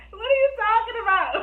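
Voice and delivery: high, loud, laughing disbelief; incredulous. Retold or imitated. Storyteller reacts to his claim about her, incredulous.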